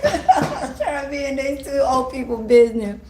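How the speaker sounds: amused self-mockery, laughing. Real, not acted. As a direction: laugh at your own nosiness. Laughing at herself nosy.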